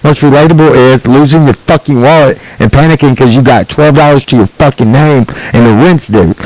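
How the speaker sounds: normal talking voice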